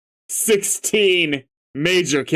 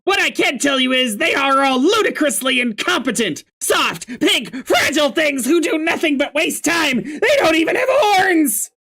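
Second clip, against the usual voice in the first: Karkat ranting; 9 semitones higher than usual. Acted. Voicing Karkat.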